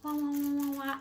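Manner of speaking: wah wah mock sobbing; playful fake crying sounds. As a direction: pretend to bawl like a baby. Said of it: "I'm sorry".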